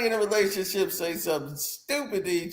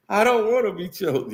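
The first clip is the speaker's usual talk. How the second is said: usual speaking voice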